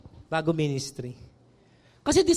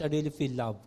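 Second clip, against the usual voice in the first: soft confession; 8 semitones lower, 7 dB quieter than usual. Retold or imitated. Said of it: "Alam mo".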